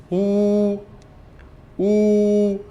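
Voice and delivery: ऊ held long and steady; round-mouthed ऊ. Shown as a demonstration. Teacher modelling pinyin w, long round-lipped ऊ. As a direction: round the lips and hold ऊ. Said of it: "मुंह round करेंगे".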